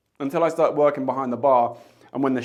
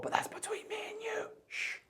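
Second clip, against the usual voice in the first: breathy conspiratorial whisper, higher and much softer; 15 dB quieter than usual. Acted. Confiding a cheeky secret to viewers, whispered. Confiding, then hushing with shh.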